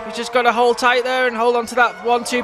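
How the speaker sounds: high, shouting race call; excited commentary. Acted. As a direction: call the finish with rising excitement.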